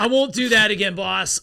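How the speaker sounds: high, loud apology to the boss; mock sheepish. Telling the coach he won't do it again, high and loud. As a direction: chirp it up high like a scolded employee. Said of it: "I was like".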